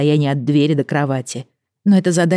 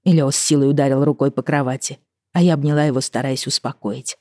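ordinary delivery, nothing marked

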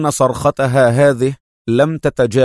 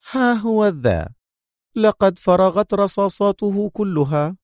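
much higher, mocking tone; sarcastic woman; 8 semitones higher than usual. The major handing over the empty gun, sarcastic. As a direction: hand it over with a sneer. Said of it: "في لهجة تحمل رنة ساخرة".

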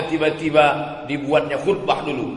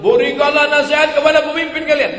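hadith recitation; 11 semitones higher, 6 dB louder than usual. Acted. Quoting a hadith aloud.

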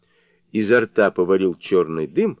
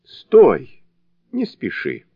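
crying out; 6 semitones higher, 5 dB louder, slower than usual. A narrator's reading. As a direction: call out high to stop him. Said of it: "они закричали ему". (высокий окрик)

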